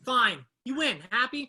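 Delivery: loud, sulky giving up; loud, grudging concession; annoyed outburst. Acted. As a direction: snap it out as the sore loser. Sore loser after video game.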